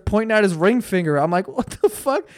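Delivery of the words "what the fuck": pitched way up in disbelief; what-the-hell reaction, much higher; incredulous. Retold or imitated. Disbelief at dumb show.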